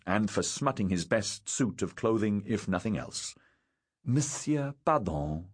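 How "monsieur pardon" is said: put-on higher opening, French accent; embarrassed French girl. A narrator's reading. Sophie apologising to Lurie, embarrassed French accent. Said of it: "Sophie reddened".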